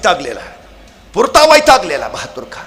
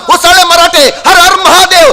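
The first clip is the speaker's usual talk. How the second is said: loud, higher battle shout; war cry; 6 semitones higher, 6 dB louder than usual. Acted. Marathas surge forward with Har Har Mahadev, loud war cry. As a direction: shout it like a charging army.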